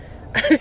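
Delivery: short high laugh. Real, not acted. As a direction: burst out laughing. Laughing in the frozen car.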